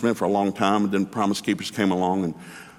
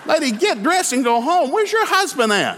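much higher, louder, slow, laughing through it; mock exasperated shout; 4 dB louder than usual. Man at the rally yelling at a protester, laughing and scandalized. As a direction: yell it half-laughing, scandalized.

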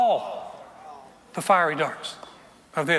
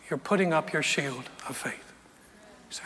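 whisper; 4 semitones lower, 6 dB quieter than usual. Real, not acted. Sermon drops to whisper.

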